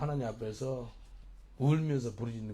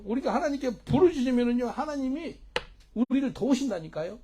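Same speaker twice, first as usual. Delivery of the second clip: high, loud revival preaching; full-force preaching; 8 semitones higher, 8 dB louder than usual. Real, not acted. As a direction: urge the congregation with a raised, ringing cry. (힘껏 외치는 부흥회 설교)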